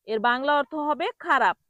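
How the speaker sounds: ordinary talk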